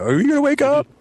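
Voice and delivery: high-pitched yell of an ex-wife; mock ex-wife calling through a window. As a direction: call it out high, rising sharply to the question. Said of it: "the ex-wife outside the window".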